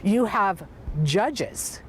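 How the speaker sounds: ordinary delivery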